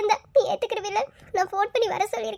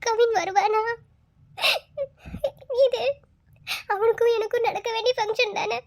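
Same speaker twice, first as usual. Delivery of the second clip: normal talking voice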